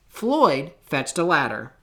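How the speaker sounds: ordinary delivery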